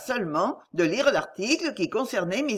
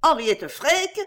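Freke? flung up high and rising, louder; vehement disbelief; 7 semitones higher, 3 dB louder, slower than usual. A narrator's reading. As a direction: question the name in outraged disbelief. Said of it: "s'écria avec véhémence". (Henriette Freke? lancé haut, montant, indigné)

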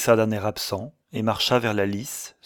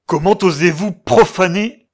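loud, high burst of outrage; outraged cry; 9 semitones higher, 11 dB louder than usual. A narrator's reading. Man seizing sailor in his fiancée's cabin, outraged shout. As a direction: cry out in indignation. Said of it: "s'écria-t-il".

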